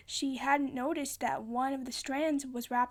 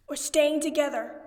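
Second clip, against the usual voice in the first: promising; 3 semitones higher, 7 dB louder than usual. A narrator's reading. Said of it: "he promised".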